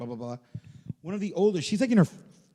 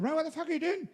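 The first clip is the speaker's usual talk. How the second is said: high-pitched Irish manager; Irish accent; 13 semitones higher, faster than usual. Retold or imitated. Manager catches a naked runner, high Irish accent. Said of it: "like, Irishman said".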